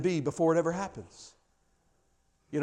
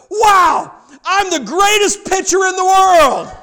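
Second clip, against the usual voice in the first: much higher, much louder, slow delighted cry; joyful shout; 14 semitones higher, 13 dB louder, slower than usual. A narrator's reading. Little boy realizing he's a great pitcher, joyful shout. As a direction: shout the discovery with a laugh. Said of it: "he shouted out as loud as he could".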